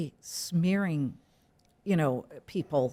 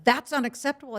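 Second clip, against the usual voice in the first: higher-pitched imitation of a scolding daughter; blurted objection; 8 semitones higher than usual. Mother quoting her daughter on accents, blurted out. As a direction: blurt it out in mock disapproval. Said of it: "she blurts out".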